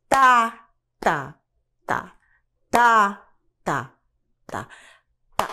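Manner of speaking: steady ternary pulse with a strong downbeat on "tá"; three-beat accent. Shown as a demonstration. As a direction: punch every third "tá", drop the two after it. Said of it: "Eu quero um compasso ternário".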